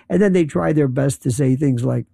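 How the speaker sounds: ordinary talk, nothing marked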